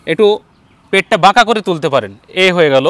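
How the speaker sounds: ordinary talk, nothing marked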